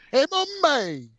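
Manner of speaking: put-on high, silly English accent; a hammed-up English lady calling mummy; mock English accent. Mocking a film's bad English accent, high-pitched. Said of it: "trying to pull off the English accent".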